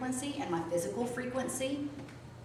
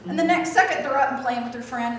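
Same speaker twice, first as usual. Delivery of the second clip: ordinary talk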